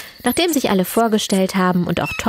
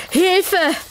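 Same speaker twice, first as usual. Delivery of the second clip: shrieking; 12 semitones higher than usual. A narrator's reading. Tom cries out at a huge figure, shrieking high. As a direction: squeal it high in alarm. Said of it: "kreischt Tom".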